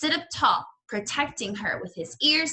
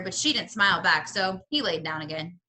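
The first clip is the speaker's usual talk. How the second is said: plain reading voice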